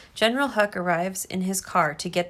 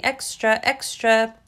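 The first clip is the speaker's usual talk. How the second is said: louder call of a newsboy hawking papers; newsboy shouting; 3 semitones higher, 5 dB louder, slower than usual. Acted. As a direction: cry it out like a street paperboy. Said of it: "hears the newsboy shouting".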